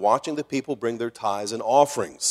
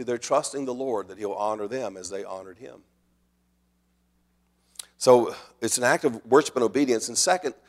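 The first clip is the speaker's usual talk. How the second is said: normal talking voice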